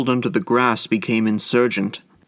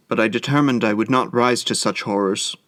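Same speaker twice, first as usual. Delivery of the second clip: usual speaking voice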